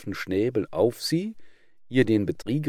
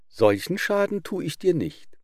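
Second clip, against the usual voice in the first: put-on high-pitched boastful young calf cry; a young calf calling out proudly, pitched high; 9 semitones higher, 3 dB louder than usual. A narrator's reading. Calf shouting to the shepherd, high and boastful. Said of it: "schrie ein junges Kalb".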